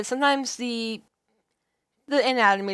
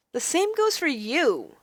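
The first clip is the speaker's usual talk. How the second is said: higher, slow, indignant; raised heroine voice, defiant; 6 semitones higher, slower than usual. Acted. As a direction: set him straight.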